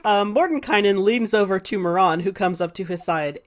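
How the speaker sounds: ordinary talk, nothing marked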